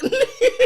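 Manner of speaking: a very high, loud burst of laughter; squealing laugh; high shriek of laughter. Real, not acted. Cracks up.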